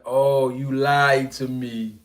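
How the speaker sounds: very slow, drawn "you lied to me"; mock-wounded movie quote; slow mock movie line. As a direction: drag it out like the film scene. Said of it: "He said".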